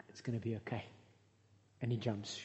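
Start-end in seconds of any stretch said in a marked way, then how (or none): 0.1-0.8 hushed soothing. Retold or imitated. Owner soothing the dog again.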